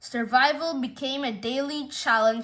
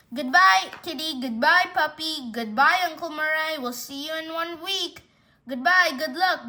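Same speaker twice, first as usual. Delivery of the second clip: higher sing-song goodbyes; called-out farewells; 4 semitones higher, 3 dB louder than usual. Acted. Owners leaving for a week, calling out higher farewells. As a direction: call out goodbye to each one in turn.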